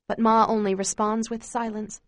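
usual speaking voice

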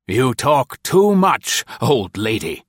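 rough contempt. A narrator's reading. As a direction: drag it out with contempt. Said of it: "Tilson ordered her roughly".

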